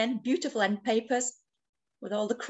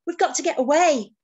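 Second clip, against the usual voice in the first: higher, louder storybook yell; Pufferfish; 6 semitones higher, 6 dB louder than usual. A narrator's reading. Pufferfish urging friends away from shark, alarmed yell. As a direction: urge escape in play alarm. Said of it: "yelled Pufferfish".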